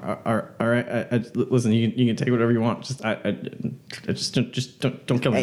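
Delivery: halting, nervous begging; stammering plea. Acted. Captive begging a gunman for his life, nervous stammer. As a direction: stumble over repeated words, pleading.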